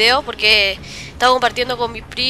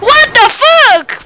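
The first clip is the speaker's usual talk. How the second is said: high, loud, drawn-out outcry; shocked yell; 12 semitones higher, 12 dB louder, much slower than usual. Real, not acted. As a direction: yell it slowly in disbelief. Shocked by death in game.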